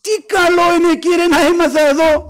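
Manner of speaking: high-pitched awestruck exclamation of a disciple; Peter. Acted. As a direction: cry it out high, as if just awakened by glory. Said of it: "Και τι άρχισε να λέει".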